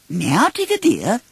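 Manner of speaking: high, gently firm motherly voice; a mother's kind but firm warning; firm Kanga. Acted. Kanga warns bouncing Tigger, high and gently firm. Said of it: "Kanga said firmly".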